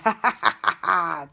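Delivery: loud sly wolf giggle. Acted. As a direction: giggle wickedly to himself. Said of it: "the wolf giggled".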